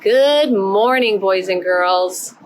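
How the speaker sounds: bright sing-song roll call; kindergarten teacher voice. Acted. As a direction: greet each little one warmly. Greets each child by name.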